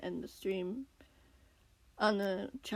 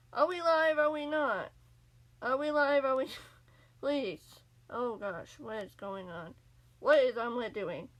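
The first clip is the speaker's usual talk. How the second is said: frantic, high, loud pleading; panicked; 4 semitones higher, 5 dB louder than usual. Real, not acted. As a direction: beg frantically, on the edge. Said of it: "I'm just panicking".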